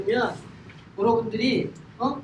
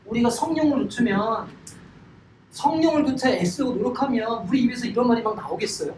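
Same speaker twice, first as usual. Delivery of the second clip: ordinary talk, nothing marked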